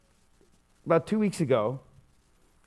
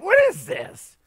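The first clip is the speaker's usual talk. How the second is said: high, loud mock outrage at a dropped call; mock angry; 11 semitones higher, 6 dB louder than usual. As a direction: squeal it out like an annoyed driver. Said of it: "I'm all angry".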